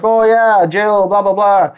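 high, loud, eager agreement; mock gossiping. People pleaser partaking in coworker's gossip, mock gossipy. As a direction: pile in with exaggerated gossipy agreement. Acts out joining in office gossip.

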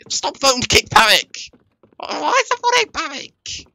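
high squeaky whine, pleading; whiny squeaky voice. Pretend. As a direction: whine like a cartoon kid. Whining in-game as fake character.

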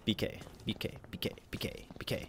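slow whispered spelling; whispered letters. Real, not acted. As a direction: spell it in a hush. Whispered letters while typing.

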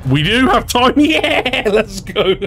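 high, laughing celebration; excited cheer. Real, not acted. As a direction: cheer it in gleeful triumph. Celebrates making it in time.

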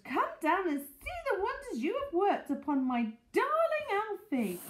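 high, swooping praise; excited gushing. A narrator's reading. Mrs Silver praising Hoppy's work on Alfie, gushing. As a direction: gush with wonder and gratitude.

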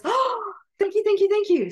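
high gasp, then loud rapid thanks; acted breathless relief; gushing relief. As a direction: overflow with gratitude, pitch way up. Queen is granted a chance.

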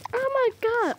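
high-pitched pretend exclamation; mock shocked outburst; play-acted shock. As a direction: cry out as if watching a stunt. Acted reaction to a backflip.

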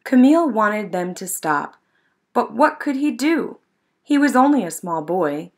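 ordinary delivery, nothing marked

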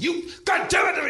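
high, loud imitation of an enraged shouter; screaming. Irate husband to clumsy busboy over spilled creamer, screaming. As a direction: yell it as the furious man being imitated. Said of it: "He just starts screaming at me".

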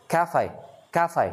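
"Cafe" high on CA, falling on fe; CA-fe, falling away; stress on first syllable. Shown as a demonstration. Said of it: "nhấn đầu lên".

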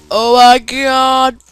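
high, very loud, slow shrieks; panicked screaming. Real, not acted. Gamer freaks out over TNT explosives, high and loud. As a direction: scream in real alarm at the blast.

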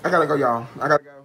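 loud, panicked urgency; urgent loud. Real, not acted. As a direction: shout it like you must leave. Mouth burning, urgent.

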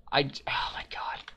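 whispered oh my god, defeated; exasperated whisper. Real, not acted. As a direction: breathe it out, giving up. Frustrated muttering at puzzle.

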